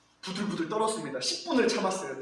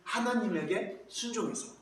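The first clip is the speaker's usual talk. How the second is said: ordinary delivery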